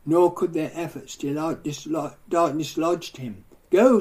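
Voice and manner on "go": sharp, louder, higher command of Go; commanding shout. Retold or imitated. Disciples ordering the evil spirit out, mock commanding shout. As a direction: bark the exorcism word like a mock order. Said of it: "said they".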